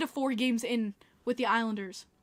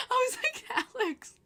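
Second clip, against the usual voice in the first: laughing high-pitched talk; 12 semitones higher than usual. Real, not acted. Retold exchange, laughing.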